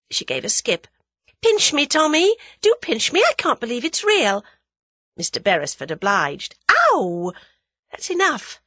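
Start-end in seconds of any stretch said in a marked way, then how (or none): 1.4-4.4 put-on high, loud excited young woman; thrilled. Thrilled girl cannot believe her luck. 6.7-8.6 high yelp of mock pain; pained protest. Pinched by Tommy.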